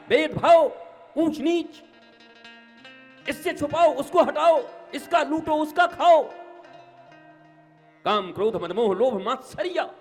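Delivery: high, loud, rising sermon; fiery preaching. Acted. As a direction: preach it with full force. Preaching at full force.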